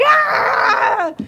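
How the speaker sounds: very high loud shriek; goblin shrieks. Acted. GM voicing the goblin chaos, very high loud shriek. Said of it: "you just hear lots of".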